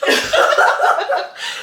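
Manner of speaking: loud breathy laugh; burst of laughter. Real, not acted. Laughing when her friend mishears a word, loud laugh.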